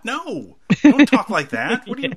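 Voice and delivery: high-pitched, incredulous mock scolding; appalled parent. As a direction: scold your kid in playful disbelief. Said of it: "if my kid was talking like that".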